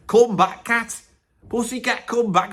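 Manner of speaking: mock call to a cat down the garden. Acts out calling his cat.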